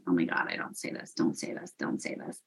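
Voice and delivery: hushed, breathless whisper to herself; urgent whisper. Retold or imitated. Warning herself mid-reading not to blurt it out, whispered. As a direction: whisper it as if begging yourself not to speak.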